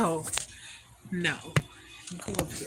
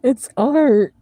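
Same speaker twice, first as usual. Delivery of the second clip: sudden loud shout; shouted joke; 5 semitones higher, 19 dB louder than usual. Real, not acted. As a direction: yell it for laughs.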